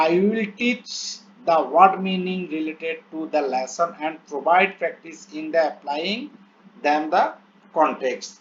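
very slow, word-by-word English reading; slow dictation reading. Shown as a demonstration. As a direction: read each English word out slowly as if dictating. Reads English plan aloud slowly. (ধীরে ধীরে শ্রুতলিপির মতো পড়া)

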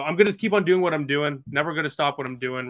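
usual speaking voice

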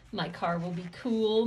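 usual speaking voice

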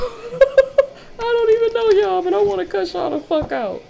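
laugh-yell in mock outrage; laughing shriek. Real, not acted. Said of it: "I'm so mad at y'all".

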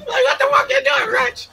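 very high yapping dog barking out words. Acted. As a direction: yelp the words like a frantic dog. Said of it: "he sounds just like the fucking dog".

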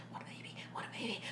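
fast breathless whisper. Acted. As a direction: hiss it fast and unvoiced. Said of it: "she's like".

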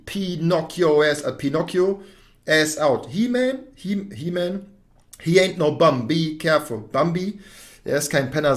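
ordinary delivery

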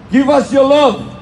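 normal talking voice

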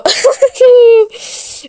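high screaming laugh; loud shriek laugh. Real, not acted.

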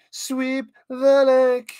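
high, slow, loud command; mock coach yell. Commentator playing a coach to Johnny, mock yell.

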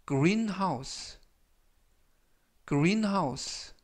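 greenhouse said slowly, every sound clear, falling tune; teacher models greenhouse for repeating; model pronunciation. Shown as a demonstration. Teacher demonstrates greenhouse for students, careful modeling. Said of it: "greenhouse라고 발음하시면 되겠고요".

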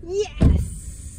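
delighted, hissed-out yes; excited. Real, not acted. Mum hears the September date, excited.

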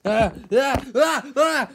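rising panicked ah-ah cries; a kid's hammed-up panic; mock panic yelps. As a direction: yelp in mock alarm. Panicking at SpongeBob.

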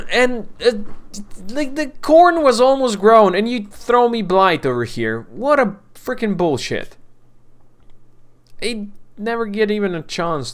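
loud high-pitched complaint; angry rant. Real, not acted. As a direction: vent at the game's unfairness. Ranting at blight.

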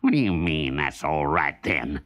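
low, suspicious fox demand; demanding Brer Fox. Acted. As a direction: press him, low and sharp. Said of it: "demanded Brer Fox".

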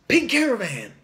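loud, high, showy reveal; excited announcement. Real, not acted. Critic unveiling the next album, excited announcement. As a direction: call the title out like a big introduction.

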